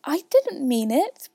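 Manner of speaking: high, slow, imploring; apologetic girlish appeal; pleading. A narrator's reading. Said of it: "pleaded poor Alice".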